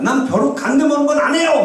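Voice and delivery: high-pitched protest in prayer; retold defiant refusal. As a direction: refuse God stubbornly, acting it out. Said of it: "하나님한테 대들었어".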